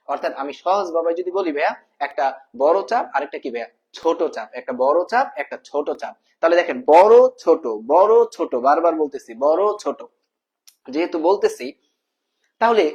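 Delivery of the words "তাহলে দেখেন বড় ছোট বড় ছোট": loud, punchy classroom drilling of বড় ছোট; louder emphatic teacher chant; drilled emphasis. Shown as a demonstration. Teacher drilling big and small.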